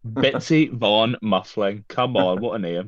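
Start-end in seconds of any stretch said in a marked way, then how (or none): 0.1-1.8 drawn-out, deliberate relish; slow, relishing pronouncement; savoured slowly. Acted. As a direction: stretch out every part of the name. Relishing a silly character name.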